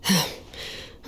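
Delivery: heavy sigh. Acted. Weary sigh before grim answer.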